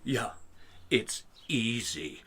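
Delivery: slow, quiet, smug dismissal; underplay it, bored and superior; mock toff shrugging it off; smug drawl. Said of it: "he says".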